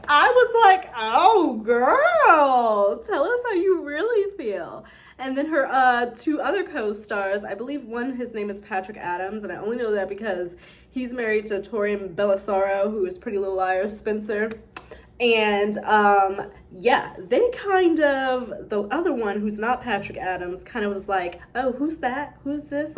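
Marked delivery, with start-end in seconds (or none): 1.0-4.9 put-on high, drawn-out teasing "oh girl"; mock-knowing reaction. Viewer reacting to the co-star's shady clip, mock-knowing. 21.5-23.0 higher, lightly mocking innocent questions; feigned ignorance. Co-star joking about not knowing Meghan, feigned ignorance. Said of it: "trying to act like he doesn't know".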